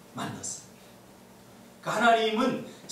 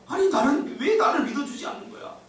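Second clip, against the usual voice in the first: high-pitched acted frustration; pleading, worked-up complaint; exasperated; 10 semitones higher, 3 dB louder than usual. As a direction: protest as if driven crazy at not being believed. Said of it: "막 미치고 환장하잖아요".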